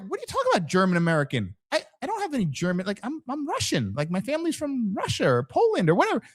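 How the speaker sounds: high-pitched, quick mock protest; baffled objection. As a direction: play someone puzzled at being mislabeled. Acted objection to a wrong label.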